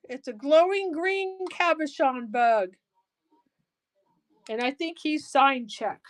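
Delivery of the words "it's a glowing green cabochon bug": ordinary talk, nothing marked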